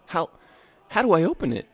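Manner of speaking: put-on high, hesitant child voice; a wary little boy asking; a boy stammering at the door; curious boy. A narrator's reading. Boy swallowed hard.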